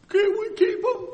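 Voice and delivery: put-on high, pleading little-boy voice; pleading kid. Boy begging his dad to keep a stray pet, high and pleading. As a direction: beg like a small boy asking for a pet.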